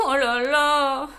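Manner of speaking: slow dismayed oh là là; Wolf groans at nightfall alone in the forest, lamenting. A narrator's reading. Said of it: "se lamenta-t-il".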